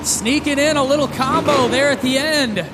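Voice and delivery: loud, pumped play-by-play; dueling hype announcers. Real, not acted. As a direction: call it like a hype man.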